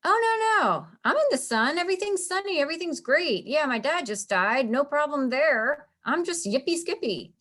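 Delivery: high, mock-cheerful sarcasm; very sarcastic. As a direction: fake sunny, over-bright protest. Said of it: "He's being very sarcastic".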